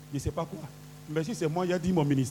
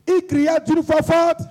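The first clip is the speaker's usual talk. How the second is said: high, ringing declamation; raised voice leading a chant; 6 semitones higher than usual. Real, not acted. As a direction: call it out to rouse the congregation. Leads congregation in shouted call.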